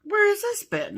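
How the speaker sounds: high-pitched mock amazement; retold astonishment. Quoting co-host on finding the kit, high astonishment. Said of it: "That's what she said to me".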